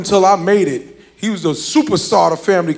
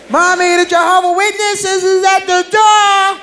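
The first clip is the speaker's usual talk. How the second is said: put-on high, slow little kid voice; child telling mom who's at the door; kid calling mom; 9 semitones higher than usual. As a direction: call out like a small child.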